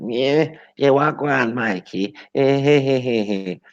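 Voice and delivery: high-pitched mimicking voice with a fake laugh; exaggerated high sing-out greeting; mocking caricature. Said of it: "when they talk and they thing, they always like".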